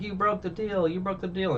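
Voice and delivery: high, put-on confrontational voice; indignant mock accusation, pitched well up. Nami confronts Arlong over the broken deal, mock accusing. Acting out character confronting villain.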